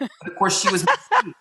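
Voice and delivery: high laughing burst through the words; telling the host, laughing at the reveal. Real, not acted.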